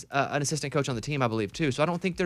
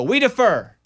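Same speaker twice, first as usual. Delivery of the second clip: mock announcement; 9 semitones higher, 8 dB louder, slower than usual. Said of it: "They go".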